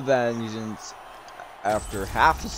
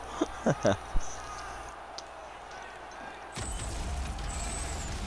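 soft, breathy laughing; long laugh; 5 semitones higher, 9 dB quieter than usual. Real, not acted. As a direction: laugh on quietly, amused. Laughs at his own boast.

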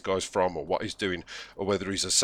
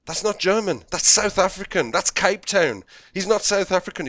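high, exasperated correction; incredulous; 8 semitones higher, 3 dB louder than usual. Acted. Director reacts to accent sounding South African, incredulous. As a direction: object in high-pitched disbelief.

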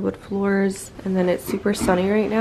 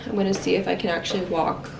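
usual speaking voice

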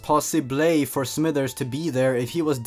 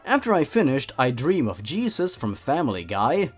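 usual speaking voice

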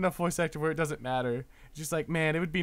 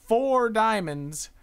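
ordinary talk

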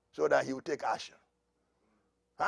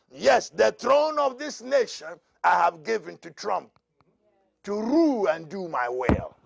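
louder prophetic declaration; preaching as the Lord; 5 semitones higher, 7 dB louder than usual. Acted. Preacher speaking God's words to the congregation, loud. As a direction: proclaim with full authority.

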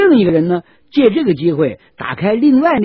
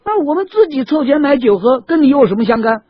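higher, louder angry protest; indignant soldiers; 11 semitones higher, 6 dB louder than usual. Acted. 士兵们气愤地顶撞杨志. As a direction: talk back at the officer in anger. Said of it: "士兵们非常气愤".

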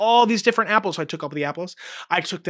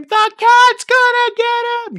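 a much higher, louder excited scream, a bit slower; kid screams; 9 dB louder, slower than usual. Retold or imitated. An excited student screams the answer at the teacher, high and loud. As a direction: shout it like a thrilled kid who knows the answer. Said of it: "someone will scream".

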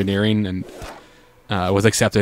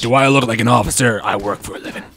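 gruff soldier voice. Pretend. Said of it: "I just have this deep voice".

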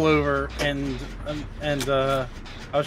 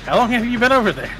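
surprised; 7 semitones higher, 7 dB louder than usual. Real, not acted. Startled question.

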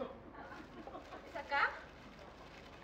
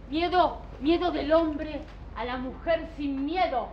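slow, very loud dramatic cry of miedo; booming proclamation; 11 dB louder than usual. Acted. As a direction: thunder the word miedo out slowly. Dramatic proclamation about fear.

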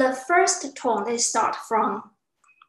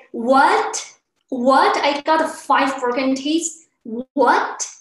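surprised; 6 dB louder, slower than usual. Acted. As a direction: react in acted disbelief to bad news. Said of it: "you are surprised".

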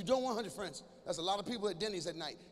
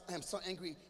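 flat angry text; 4 semitones lower, 8 dB quieter than usual. Retold or imitated. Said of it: "Capital letters".

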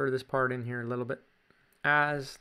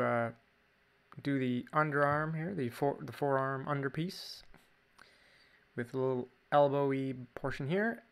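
ordinary delivery

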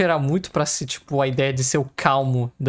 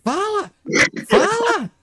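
excited shouting; 14 semitones higher than usual. Acted. Excited yelling at the game.

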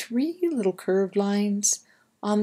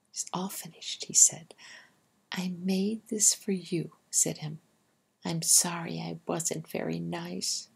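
hushed, much softer little mouse; soft apology; 7 dB quieter than usual. Acted. Imp giving mommy his drawing, hushed and sorry. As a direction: whisper it shyly, almost to yourself.

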